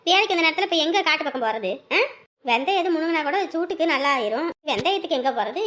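ordinary delivery

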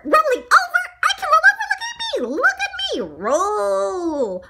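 very high, loud squeaky little voice; excited showing off. Acted. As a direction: squeal it, then a long drawn "roll". Donut excitedly shows off trick.

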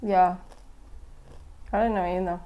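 talking while chewing; eating snack crackers; mouth full. Real, not acted. Seen 0: Bites snack at -4s, mouth working while holding pieces.

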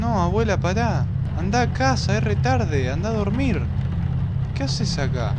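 high, frantic pleading; panicking gamer. Real, not acted. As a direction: beg her to leave, panicking. Pleading with creepy grandma ghost.

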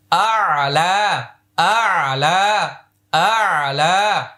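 আ'লা very slowly, long first vowel; drawn-out recitation. Shown as a demonstration. Teacher drawing out আ'লা for the class. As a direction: stretch আ'লা slowly for learners. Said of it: "ইয়াটা উচ্চারণে আসবে না".

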